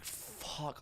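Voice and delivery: whispered swear of dread; barely voiced, sinking-feeling curse; hushed curse. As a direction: whisper it to yourself as you realize you're caught. Dread on being pulled over.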